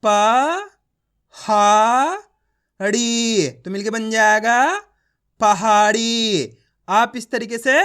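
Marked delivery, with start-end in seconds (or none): 0.0-6.6 प, हा, ड़ी each held long, then पहाड़ी stretched out; slow syllable-by-syllable reading; direct syllable sounding. Shown as a demonstration. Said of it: "direct मात्रा का उच्चारण करके पढ़ेंगे".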